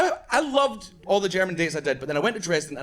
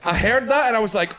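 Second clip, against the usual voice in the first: usual speaking voice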